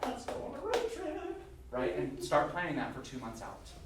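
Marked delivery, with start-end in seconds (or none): 0.0-1.4 high, excited suggestion; mock excitement. Teacher voicing a student eager for a road trip. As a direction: pitch it up like an eager idea. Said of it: "be like".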